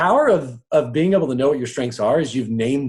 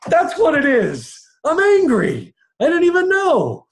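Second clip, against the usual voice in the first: loud, high-pitched outburst of sudden discovery; mock-astonished cry, stretched and high; exclaimed realisation; 5 dB louder than usual. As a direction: blurt it high and loud, drawing out the words. Acting out sudden realisation of anger.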